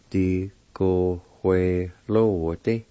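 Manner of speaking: "Di, go, hue, lo, di" with short gaps; measured "Di, go, hue, lo, di", syllables separated; broken into syllables. Shown as a demonstration. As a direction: sound out "Di, go, hue, lo, di" part by part. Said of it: "It's pronounced like this".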